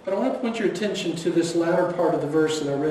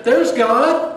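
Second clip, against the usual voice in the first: loud, high proclamation of awe; mock awe; 6 semitones higher, 8 dB louder than usual. World gasping at the wind, loud awe. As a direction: announce it like the world impressed. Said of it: "And the world would say".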